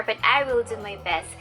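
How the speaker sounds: normal talking voice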